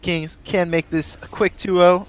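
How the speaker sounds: big play-by-play opening; esports caster intro. Real, not acted. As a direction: kick off the broadcast with caster punch. Switches into live casting.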